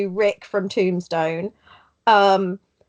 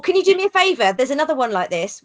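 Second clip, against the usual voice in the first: ordinary talk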